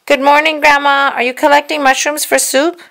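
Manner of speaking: put-on Baby Bear calling to grandma. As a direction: call out like a small cub. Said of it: "Baby Bear called out".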